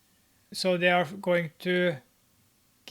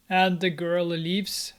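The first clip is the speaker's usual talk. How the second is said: ordinary talk, nothing marked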